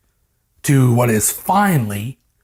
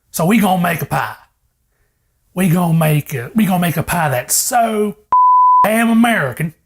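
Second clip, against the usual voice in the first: mock Georgia country cook; half-hearted Southern accent; 4 semitones higher than usual. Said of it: "I'm gonna put on an accent".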